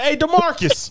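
very high-pitched imitation of a mother calling; mock mom calling out. Mom to son's friend from window, calling him out. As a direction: call him out like a mom with questions.

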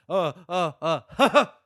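loud, high mock gasps breaking into ha-ha; imitated gasps. Fan acting out her getting out, loud imitated gasps. Imitates escape sounds from film scene.

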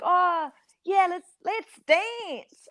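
hammed-up, drawn-out vowel on dance; exaggerated dance. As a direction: overdo the drawl on dance. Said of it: "I was exaggerating a bit".

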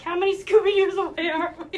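put-on high and loud, like an eager kid; excited child asking. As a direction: ask like a kid on a road trip. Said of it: "I'm just like".

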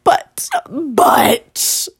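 tearful breathy whisper, voice almost gone; half-cry the words out in a whisper; choked-up whisper. Real, not acted. Vlogger choking up about favourite bakers, tearful whisper.

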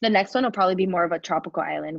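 quick, audible restatement at full voice; clearer repeat. Real, not acted. Guest answering the host's "where, sorry?", clearer repeat. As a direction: repeat it plainly for someone who missed it. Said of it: "The where, sorry?".

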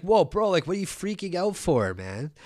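a hint of a clueless dude brushing it off; mocking a casual bro. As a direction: play dumb like a chill bro caught out. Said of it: "And they're just like".

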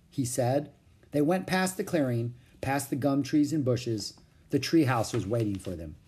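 usual speaking voice

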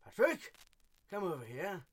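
loud, high calling dad; Patrick's dad voice. Shown as a demonstration. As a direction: call out to Patrick like his dad. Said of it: "I could be like Patrick's dad".

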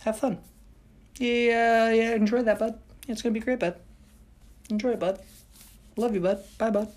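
dismissive cheery locals waving him off; mock breezy send-off. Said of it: "This time they were like".

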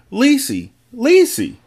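high, rising searching call. A narrator's reading. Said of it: "I called as I went".

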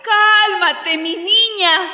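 ordinary delivery

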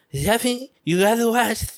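high-pitched, badly faked Castilian Spanish accent; hammed-up Spanish accent, pitched well up; mock Castilian accent. Imitating his sister in Spain, bad Castilian accent. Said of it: "the Castilian accent".

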